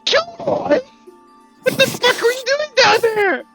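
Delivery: very high, loud outraged shriek; hammed-up scolding screech; shocked yell. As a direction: scream it in disbelief. Captain shrieks at Kilroy, shocked.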